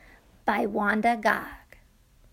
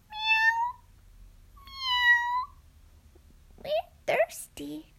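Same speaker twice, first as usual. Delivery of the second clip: very high squeaky kitty voice; meowing cats; much slower than usual. Acted. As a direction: mew like a thirsty kitten. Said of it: "cried the hundreds of cats".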